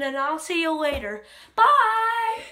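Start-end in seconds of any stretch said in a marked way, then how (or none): 1.5-2.4 loud, high goodbye call; shouted sign-off. Real, not acted. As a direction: call out a big farewell. Calling out the sign-off.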